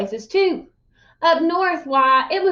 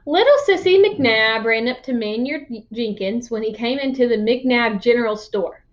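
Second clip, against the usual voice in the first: ordinary delivery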